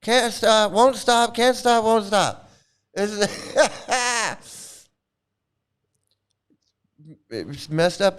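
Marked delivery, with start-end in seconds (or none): none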